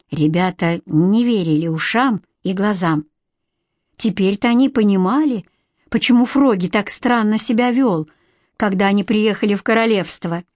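ordinary delivery, nothing marked

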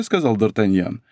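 ordinary delivery, nothing marked

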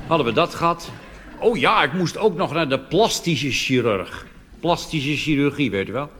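ordinary delivery

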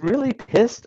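can you believe someone said this; mock-incredulous repeat; incredulous quote. Mocks the phrase as technical term.

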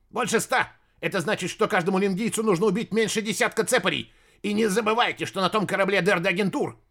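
high, fast panicked ranting; frantic panic. Acted. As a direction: rattle it off in panic. Panicking master argues.

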